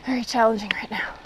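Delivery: out of breath, breathy words; breathless from the climb; gasping between phrases. Real, not acted. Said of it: "And I'm out of breath".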